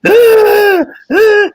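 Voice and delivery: high loud imitated shrieks; loud high imitated shrieking; mock shriek. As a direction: squeal like she did. Mimicking her shrieking reply.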